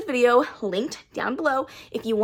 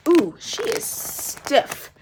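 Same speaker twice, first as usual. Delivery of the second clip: slow, breathy whisper; 3 semitones higher, much slower than usual. Real, not acted. Feeling the hardback.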